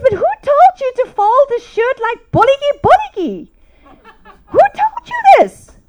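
high, loud mock-outraged mother; scolding outburst. Mom confronting her son about a folded shirt, scolding.

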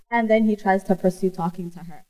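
ordinary talk, nothing marked

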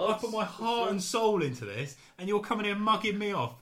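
high-pitched indignant rant; wounded salesman working up a hammed-up rage; acted furious. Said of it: "He gets furious".

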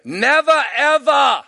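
loud, high Australian crowd shout; Australian accent. Retold or imitated. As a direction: call it out Australian-style, no final r. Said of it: "That's Australian".

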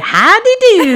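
high, loud folksy howdy; cheery howdy greeting. Retold or imitated. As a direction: sing out a hokey hello. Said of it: "said we should say".

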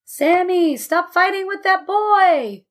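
high, drawn-out parental yell. A narrator's reading. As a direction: call across the park, scolding, slowly. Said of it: "would stand and yell".

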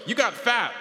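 high, loud mock auntie blurt; blunt relative. Relative greeting her at Thanksgiving, high blunt mock blurt. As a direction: blurt it out like a tactless aunt. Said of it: "they're just like".

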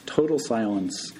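usual speaking voice

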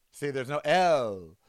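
usual speaking voice

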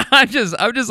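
real giggle breaking through the words; bubbling over with giggles; giggling. Real, not acted. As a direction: laugh as you try to get the words out. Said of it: "giggling at this point".

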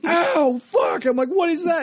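high-pitched re-enacted yelp of pain; pained outcry. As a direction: cry out as if jabbed by a needle. Retelling pain from the needle.

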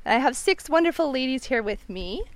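loud, bright welcome to the show; upbeat show intro. Real, not acted. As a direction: open the episode with energy. Host opens the show.

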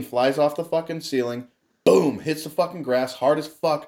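ordinary talk, nothing marked

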